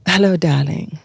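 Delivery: lightly put-on British accent; British woman. British woman greeting a new model at the agency, imitated British accent. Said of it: "there was this British woman and she was like".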